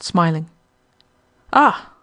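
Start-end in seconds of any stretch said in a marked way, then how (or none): 1.5-1.9 sharp, higher, louder "Ah!"; startled exclamation. A narrator's reading. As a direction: jump up startled. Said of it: "exclaimed Rocco".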